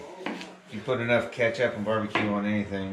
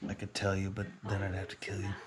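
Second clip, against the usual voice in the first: mock secretive; 10 dB quieter than usual. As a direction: hush it like a secret.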